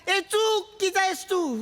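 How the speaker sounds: retold shout. Rival archer shouts at him mid-shot, imitated slow high yell. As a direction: call it out slowly, a notch up, like she's scolding him. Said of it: "असं ती ओरडली".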